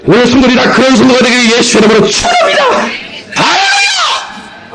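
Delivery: high preaching cry rising into a shout; high, stretched-out hallelujah shout; fervent blessing. Real, not acted. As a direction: proclaim it over the congregation.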